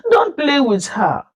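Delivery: put-on a mother warning her kids off; shouted warning. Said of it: "one mother shouted".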